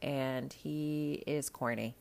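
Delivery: ordinary delivery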